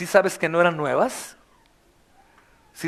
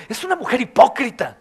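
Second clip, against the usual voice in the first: higher, louder scornful gossip; louder mock of scornful churchgoers; mocking critics; 7 semitones higher, 5 dB louder than usual. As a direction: sneer at her as a self-righteous critic. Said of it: "algunos están diciendo".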